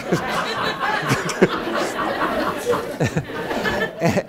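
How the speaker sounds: airy, high-pitched chuckle; high breathy laugh. Real, not acted. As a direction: let out a silent wheezing laugh. Laughs at own story.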